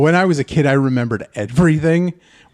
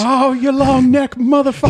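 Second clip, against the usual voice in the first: higher, taunting trash talk; high mocking dinosaur insult; 9 semitones higher than usual. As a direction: sneer it up high at the long neck. Dinosaurs insulting each other.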